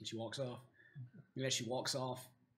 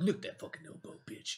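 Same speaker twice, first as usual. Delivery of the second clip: hissed dwarf taunt; 8 dB quieter than usual. Acted.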